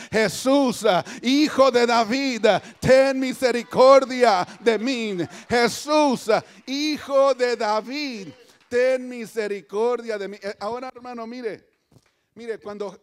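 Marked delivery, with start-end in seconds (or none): 0.1-10.4 loud, drawn-out plea; crying out. Acted. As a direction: cry out slowly for mercy from the pulpit. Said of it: "Y empieza a gritar". (clamor a gritos, suplicante)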